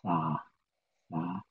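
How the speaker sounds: sa said softly with a stretched ah; word sa demo. Shown as a demonstration. Teacher to students, modeling sa quietly. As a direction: breathe out the sa gently. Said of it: "Say the word".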